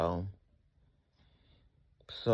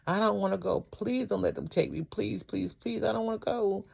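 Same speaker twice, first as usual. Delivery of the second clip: retold crying plea, high-pitched; tearful pleading; 10 semitones higher than usual. Patient begging wife and daughter not to let medics take them, tearful pleading. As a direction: re-enact begging your family in a high, thin cry. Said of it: "I started crying. I called to my wife".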